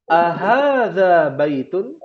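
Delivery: drawn-out "Ahadza baitun?" as a question to echo; teacher reads the question slowly for students to repeat; slow model question. Shown as a demonstration. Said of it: "Ana akan bacakan".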